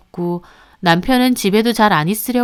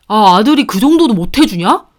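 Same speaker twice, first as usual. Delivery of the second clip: loud, defensive husband snapping back; husband flaring up defensively at wife; snapping back angrily; 5 dB louder than usual. Acted. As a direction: bark it back at his wife. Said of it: "도리어 버럭하며 성질을 내더라고요".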